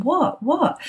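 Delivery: high, rising puzzled what; curious what. Retold or imitated. Friend arrives laughing.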